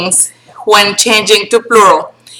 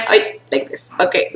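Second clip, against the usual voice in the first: whisper; 2 semitones lower, 8 dB quieter than usual. Real, not acted. Aside while writing, whisper.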